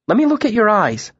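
high, anxious concern; worried. A narrator's reading. Bast wants to check Kvothe for concussion, worried.

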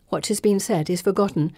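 usual speaking voice

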